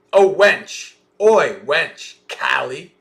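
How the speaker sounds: loud mock-scolding mistress shout; calling out sharply. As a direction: holler for the maid like a bossy mistress. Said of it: "That's so Australian".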